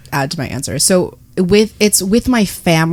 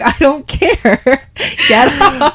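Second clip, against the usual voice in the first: higher, laughing dismissal; laughing brush-off; 6 semitones higher, much slower than usual. Retold or imitated. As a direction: laugh while shoving him off. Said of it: "I'm like".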